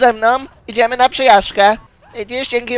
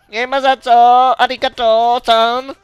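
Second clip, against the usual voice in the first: mock Japanese politeness; 5 dB louder, slower than usual. Slow put-on voice.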